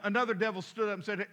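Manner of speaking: normal talking voice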